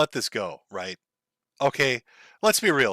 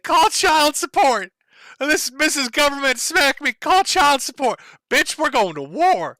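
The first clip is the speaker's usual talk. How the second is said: put-on whiny kid threat; 10 semitones higher, 3 dB louder than usual. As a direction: whine it like a furious child. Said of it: "but he's like".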